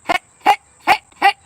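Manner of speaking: high, loud "hey hey" call. Real, not acted. Streamer calling out to her dog, loud and high. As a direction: call out to get her attention.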